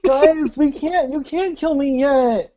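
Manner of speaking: high-pitched whine of a thwarted villain; pleading villain. Acted. Game master as villain interrupted mid-monologue, high pleading. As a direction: protest in a high, put-on villain voice. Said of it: "I imagine he's trying to go through this soliloquy".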